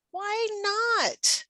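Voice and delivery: ordinary talk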